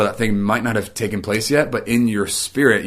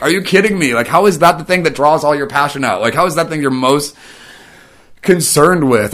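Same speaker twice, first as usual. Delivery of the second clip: higher, louder, fully exasperated are you kidding me; exasperated disbelief; 6 semitones higher, 5 dB louder than usual. Retold or imitated. Imitating his own reaction to complaining friends, exasperated and higher. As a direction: throw up your hands at the friend, much higher. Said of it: "Or I'm like".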